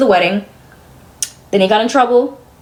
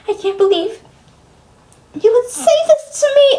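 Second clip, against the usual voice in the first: 1 mocking mom's hurt voice; 11 semitones higher than usual. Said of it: "she's like".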